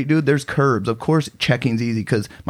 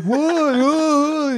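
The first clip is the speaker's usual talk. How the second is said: caught off guard. Retold or imitated. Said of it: "He's like".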